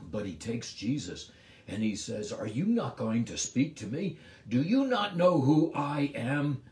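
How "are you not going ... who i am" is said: building to a louder, resentful demand; sarcastic resentment. Acted. Pilate resenting Jesus's silence, growing louder. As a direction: rise into an indignant "who I am". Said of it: "it's with sarcasm. It's with resentment".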